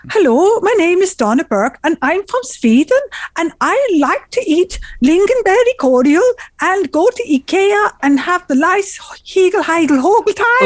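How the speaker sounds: high, sing-song fake Swedish; cartoonish Swedish accent; bad Swedish accent. Shown as a demonstration. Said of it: "let me do my Swedish accent".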